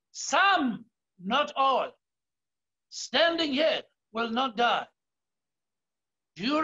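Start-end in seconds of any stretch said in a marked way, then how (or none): 0.1-4.9 "some" set apart, then "not all" lower; emphasize "some" in the quote; stress on some. Shown as a demonstration. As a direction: hit "some" and drop for the aside. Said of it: "I want to emphasize the words".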